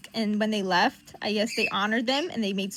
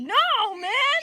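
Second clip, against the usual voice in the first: loud, high protest; stubborn refusal; 12 semitones higher, 8 dB louder than usual. Acted. Johnny refusing to drop it, high stubborn protest. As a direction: refuse loudly with raised pitch.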